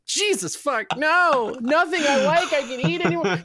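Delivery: very high, exasperated whine; hammed-up high-pitched complaint; mock despair. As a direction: wail as the fed-up patient. Retold patient despairing over banned foods.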